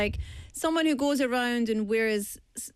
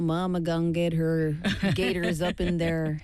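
put-on southern accent; 3 semitones lower than usual. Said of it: "I love the southern accent".

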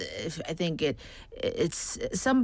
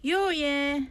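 hammed-up high call to a waiter; overdone Busan shout; Busan dialect call; 8 semitones higher than usual. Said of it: "I was hamming it up".

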